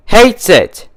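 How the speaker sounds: high, loud delighted burst; gleeful exclamation. A narrator's reading. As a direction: crow it with delight. Gleeful exclamation about teacher.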